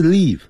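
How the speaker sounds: "live" with a long ee, pitched high; mispronounced LIVE. Shown as a demonstration. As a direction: wrongly stretch "live" into "leave". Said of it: "不是live". (高声把 live 错念成长音 leave)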